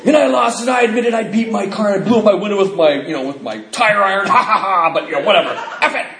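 high, loud mock voice, laughing through it; mocking a careless drunk. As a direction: ham up a drunk shrugging off his wreckage. Mocking a newcomer's excuses.

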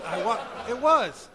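slow laughing aside. Real, not acted. Speaker cracking up at his own joke before the audience. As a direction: laugh through the words.

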